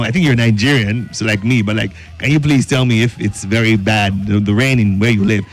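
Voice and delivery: normal talking voice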